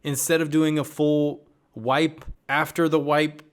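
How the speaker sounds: usual speaking voice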